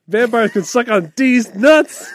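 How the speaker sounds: high, slow shouted punchline; loud joke. Acted.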